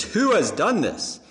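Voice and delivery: the king wanting to know who did it; demanding king. Acted. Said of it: "when the king says".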